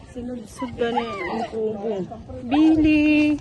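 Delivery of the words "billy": loud call for the dog. Real, not acted. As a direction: shout the name across the yard. Calling the dog loudly.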